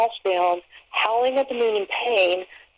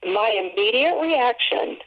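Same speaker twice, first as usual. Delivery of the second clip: ordinary delivery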